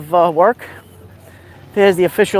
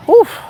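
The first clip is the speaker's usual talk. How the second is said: short, high, louder exclamation; high oof; 13 semitones higher, 5 dB louder than usual. Real, not acted. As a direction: let out a sudden high-pitched oof. Reacting to the spraying water.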